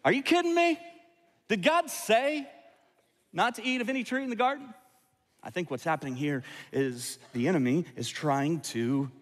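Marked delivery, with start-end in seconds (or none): 0.0-4.7 a high, disbelieving serpent sneering at the rule; snake with an attitude. Retold or imitated. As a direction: play the sassy talking snake, mocking God's command. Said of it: "did he say it with an attitude?".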